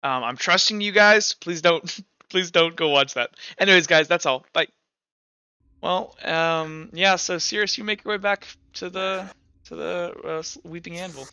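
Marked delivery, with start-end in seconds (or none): none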